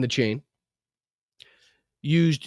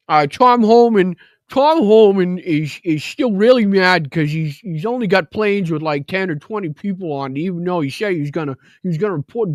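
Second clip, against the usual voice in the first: lip pulled down, mock grumpy; Tom Homan impression. Streamer mocking Tom Homan with lip held down. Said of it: "I can only do his voice by literally holding my lip down".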